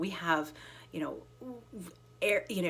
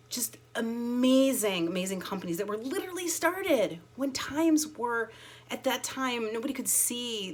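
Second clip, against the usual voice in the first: excited; 5 semitones higher than usual. Real, not acted. Emphatic enthusiasm about companies.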